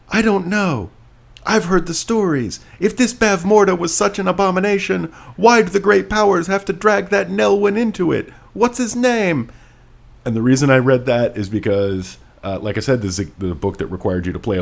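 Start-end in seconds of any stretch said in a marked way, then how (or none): 0.1-9.5 lightly voiced higher character, questioning; new character voice. Reader voicing a second character, lightly higher. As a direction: read it as a new, doubtful character. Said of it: "New character".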